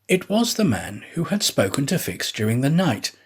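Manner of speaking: usual speaking voice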